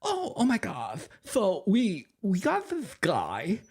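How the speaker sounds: high slow mock voice of Becca to the producer; mocking impression. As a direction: drag it out like a smug gossip. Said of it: "She's like".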